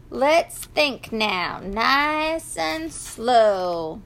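slow, drawn-out farmer reassurance; unhurried and steadying; calming, slow. Acted. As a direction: stretch out each word to settle them. Farmer settles the animals down.

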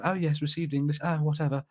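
ordinary delivery

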